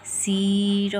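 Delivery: सीर held long and loud on the ee; stressed long ee. Shown as a demonstration. Teacher explaining why long ee is written, stressed long ee. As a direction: push and stretch the ee in सीर. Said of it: "ज़्यादा stress देकर बोलनी पड़ती है".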